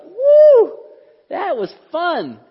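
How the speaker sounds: very high, loud whoop, then a slow "that was fun"; mock cheer. Preacher imitating churchgoers driving home, whooping cheer. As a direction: whoop like a fan leaving a show. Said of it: "be like".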